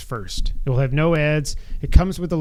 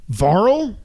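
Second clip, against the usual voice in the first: incredulous; 5 semitones higher, 3 dB louder than usual. Real, not acted. Incredulous at Varl figure.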